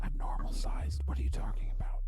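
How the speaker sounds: low unvoiced serpent whisper; whispering snake voice. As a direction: hiss it softly, offended.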